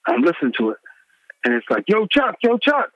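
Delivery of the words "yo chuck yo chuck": high, excited calls of the name; pitched-up mock hype-man shout-out. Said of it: "the [name]'s, [name]'s rant".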